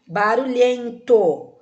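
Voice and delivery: "Barulhento" said slow and loud, last syllable held out; stressed ending. Shown as a demonstration. Teacher shows children the masculine word, "Barulhento" slow with stressed final "to". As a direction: stretch "Barulhento", leaning on the final "to".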